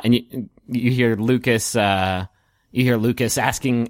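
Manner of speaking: ordinary delivery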